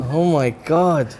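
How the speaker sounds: ordinary talk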